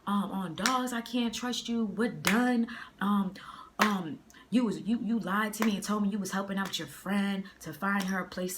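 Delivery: heated rant, imitating her yell; retold yelling. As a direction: act out a girlfriend blowing up. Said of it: "So she's yelling. She's like".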